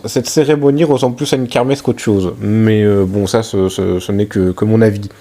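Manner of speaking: loud, fast recap voice; voice-over narration. Real, not acted. (voix off de narrateur)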